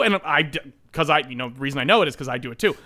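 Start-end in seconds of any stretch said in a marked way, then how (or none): none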